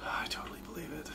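a quick whispered jab; whispered aside. Real, not acted. Teasing a friend in a whisper. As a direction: whisper it dry.

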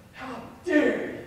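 a hint of a schoolgirl telling them off; mock indignation. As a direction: scold the class on her behalf. Girl defends him in class.

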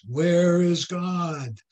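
slow, firmer question; a stern minister slowly pressing a boy. Acted. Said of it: "in a little louder voice".

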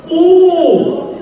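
high, loud exclamation of surprise; surprised oh. Real, not acted. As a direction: react big to the result. Host reacts to vote.

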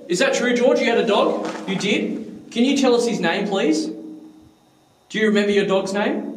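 high, polite, hopeful questions; eager questioning. Real, not acted. Investigator asking George about his dog, eager questioning. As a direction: coax an answer with lifted pitch.

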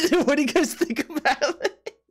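high-pitched question through real laughter; laughing. Real, not acted. Streamer showing chat the drawing, laughing. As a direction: crack up while asking.

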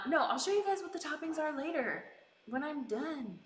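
ordinary talk

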